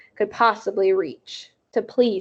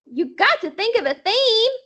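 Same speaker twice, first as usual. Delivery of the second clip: put-on much higher, bossy little girl; 10 semitones higher than usual. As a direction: boss them like a tiny kid. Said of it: "my Sweetie Pie Thomas voice".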